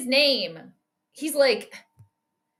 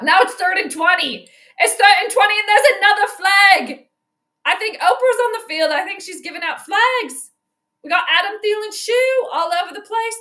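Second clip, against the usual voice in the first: excited commentary; 7 semitones higher, 6 dB louder than usual. Real, not acted. Reacting live to football penalties.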